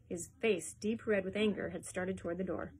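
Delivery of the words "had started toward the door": ordinary delivery, nothing marked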